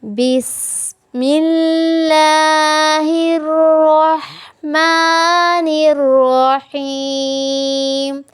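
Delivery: slow, melodic tajweed recitation; Quran recitation. Shown as a demonstration. Teacher recites for her students with slow melodic tajweed.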